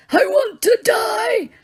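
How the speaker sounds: high, slow, shouted wish to die; old man screaming in anguish. Acted. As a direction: scream in unbearable pain. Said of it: "began to scream in more anguish".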